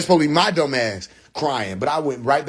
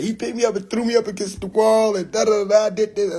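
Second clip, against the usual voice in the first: high-pitched imitation of a tattling brother; mock complaint, trailing into da-da-da; 7 semitones higher than usual. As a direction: rattle off the accusation up high. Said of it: "That's what he said".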